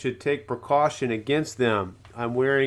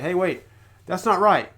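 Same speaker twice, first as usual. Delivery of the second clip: surprised objection; 5 semitones higher, 6 dB louder than usual. Retold or imitated. Imagined objector calling out.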